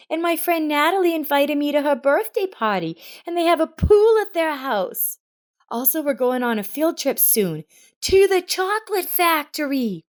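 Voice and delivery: put-on high, eager little girl's voice; excited chatter. A narrator's reading. Girl rushes to tell her mother, excited. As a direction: bubble over with news. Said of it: "in such a hurry to share".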